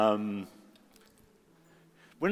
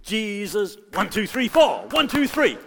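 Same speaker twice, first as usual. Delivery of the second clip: counted claps; 9 semitones higher, 6 dB louder than usual. Shown as a demonstration. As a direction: call "Jesus", then count the beats up high. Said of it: "So what we have to do there is".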